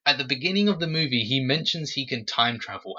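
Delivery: ordinary talk, nothing marked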